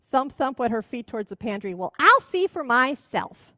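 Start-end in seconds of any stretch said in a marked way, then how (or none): 1.7-3.5 put-on determined old witch. Witch stomps to pantry.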